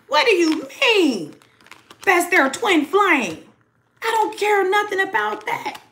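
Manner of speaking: high, slow, scoffing disbelief; indignant third party. Acted. The third party after the reading, high and scoffing. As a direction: scoff at the news like the jealous rival.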